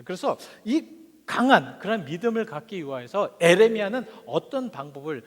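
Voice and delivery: normal talking voice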